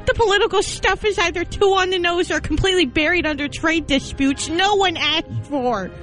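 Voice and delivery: normal talking voice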